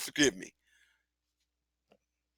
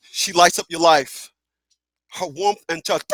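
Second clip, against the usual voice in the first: ordinary talk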